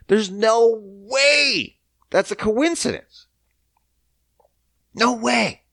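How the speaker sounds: high, drawn-out incredulous quote; hammed-up incredulity; mock disbelief. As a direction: voice up high, acting out an objection. Acts out the objection nobody made.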